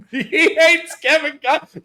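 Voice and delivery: high-pitched, loud laughing exclamation. Real, not acted. Podcaster cracking up at cohost's hate for Kevin Costner, laughing. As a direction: squeal it through laughter. Amused outburst at cohost's rant.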